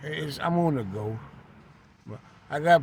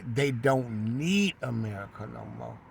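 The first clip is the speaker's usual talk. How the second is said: usual speaking voice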